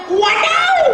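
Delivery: very high, loud mock ouch; comic pain yelp. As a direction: yelp it like a clown stepping on a tack. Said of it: "Apakah Abu Bakar bilang". (teriakan kesakitan yang dibuat-buat, tinggi dan keras)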